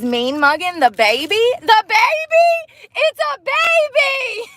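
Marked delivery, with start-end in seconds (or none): 1.6-4.5 high, slow, stretched disbelieving cry; incredulous outcry. Retold or imitated. Retelling her date glaring at a baby, incredulous outcry.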